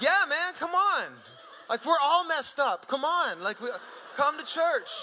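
high, excited pleading; eager invitation. Retold or imitated. Pastor retells urging a stranger to church, high and eager. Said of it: "And I'm like".

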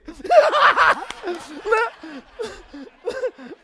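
loud high cackling laugh; big laugh. Acted. Preacher laughing at his own joke, loud cackle. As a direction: roar with laughter at the punchline.

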